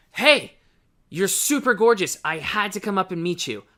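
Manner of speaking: loud, high, breathless blurt; overeager rush. Shown as a demonstration. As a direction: burst out after sprinting up to her. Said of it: "like a crazy person".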